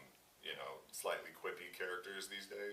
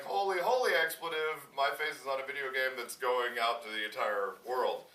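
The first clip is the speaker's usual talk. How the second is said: loud, higher, sinking as it goes; acted overwhelmed realization; mock dawning alarm; 6 semitones higher, 9 dB louder than usual. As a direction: start loud, then sink as it hits you. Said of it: "and then you're like".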